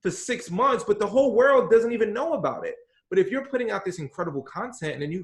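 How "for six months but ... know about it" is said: louder, higher, pressing the point; emphatic. Real, not acted. Coach pressing the point to a listener, emphatic. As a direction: drive it home with passion. Stressing the lonely effort.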